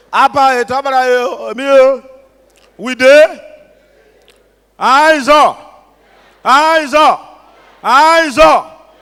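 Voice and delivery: high, very loud rallying shout; loud crowd call. Real, not acted. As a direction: call out to the whole hall to get a response. Calls out to audience in Ijaw.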